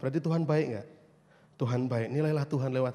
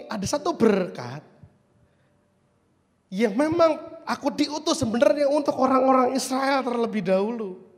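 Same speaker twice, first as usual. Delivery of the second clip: loud, raised preacher's proclamation; declaimed quote, higher and louder; emphatic declaration; 8 semitones higher, 6 dB louder than usual. Retold or imitated. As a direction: proclaim it with force. Preacher declaims Jesus' quoted words.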